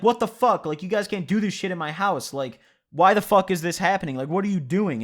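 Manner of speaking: loud, high, outraged yelling at the guys; angry yell. Retold or imitated. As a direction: shout it, furious that a fight broke out in her house. Lauren yelling at them in house.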